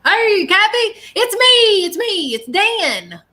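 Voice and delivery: high, loud, drawn-out holler; man calling out. Retold or imitated. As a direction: call out eagerly to get Kathy's attention. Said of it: "There was, like, a man like".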